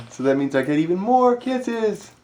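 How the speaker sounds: high, slow cooing; sing-song it to the dog; doting pet voice. Real, not acted. Talking to the dog.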